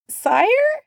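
high frightened squeak. Acted. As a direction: squeak it out in terror. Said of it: "he squeaked".

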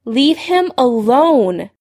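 drawn-out, insistent order; firm repeated demand. A narrator's reading. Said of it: "Lily repeated".

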